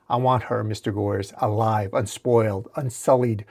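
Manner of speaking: ordinary delivery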